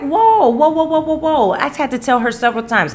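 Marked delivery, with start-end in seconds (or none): 0.0-1.5 high, held string of whoas; mock halting someone mid-rant, pitched right up; retold alarmed whoa. Retold reaction to her screaming.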